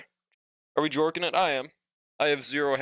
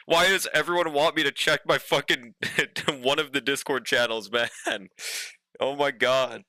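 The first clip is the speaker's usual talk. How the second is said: ordinary talk, nothing marked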